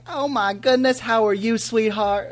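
high southern grandma greeting; put-on southern accent. Grandson imitating grandma's phone hello, in a southern accent. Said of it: "I give her a southern accent".

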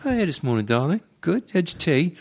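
high-pitched, sad attempt at a Manchester accent; Ian McShane. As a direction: put on a poor Manchester accent. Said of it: "my Manchester accent is pretty sad".

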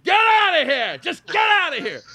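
high, loud mock bellow of dismissal; retold angry yell. Fed-up bar owner throwing out employee, mock angry shout. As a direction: imitate an exasperated boss yelling high.

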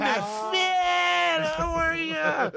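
very high, drawn-out mock shout; gushing greeting. Phony nice guy hailing someone, high exuberant shout. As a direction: holler it like a thrilled phony friend.

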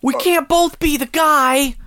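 high squeaky anime voice; high cute anime girl. Pretend. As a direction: keep the anime pitch up. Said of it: "Do your best anime voice".

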